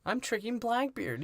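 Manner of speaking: high mock-female voice; hammed-up high-pitched boast; woman character's confiding. Voicing the woman's explanation.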